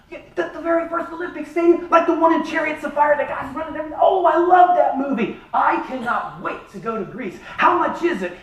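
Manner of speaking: high, gushing excitement over the movie; excited gushing. Retold or imitated. Husband gushing to the travel agent, high and excited. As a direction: gush like a kid about the trip.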